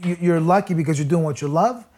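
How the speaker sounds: ordinary talk, nothing marked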